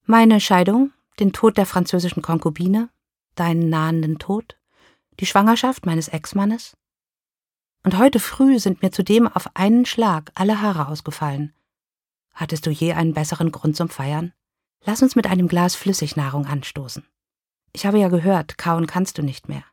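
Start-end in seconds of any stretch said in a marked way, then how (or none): none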